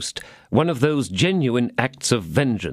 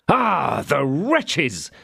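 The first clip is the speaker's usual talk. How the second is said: angry cry; 6 semitones higher, much slower than usual. A narrator's reading. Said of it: "cried D'Artagnan".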